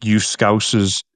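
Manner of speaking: slow, pointed name-calling; mild mock insult. Shown as a demonstration. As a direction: drag it out as an understated put-down. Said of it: "the tone of it completely changes".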